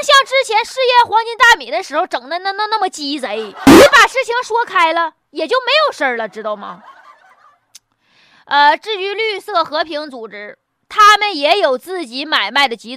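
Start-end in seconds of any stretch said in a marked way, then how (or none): none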